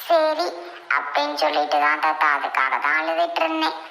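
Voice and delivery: put-on high child voice, making excuses; pleading child. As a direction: explain it to grandfather like a small child. Child explains crying to grandfather.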